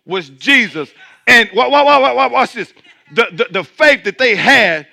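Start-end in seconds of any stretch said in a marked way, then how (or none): 1.3-4.9 loud, rising preacher's fervor; preaching. Real, not acted. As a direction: preach it out with full force. Preaching at full force.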